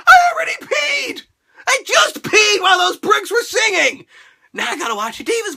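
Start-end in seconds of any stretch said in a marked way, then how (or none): 0.1-4.1 high, loud comic shout; much higher indignant yell; exasperated shout. Real, not acted. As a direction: yell in mock frustration. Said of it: "comic outburst".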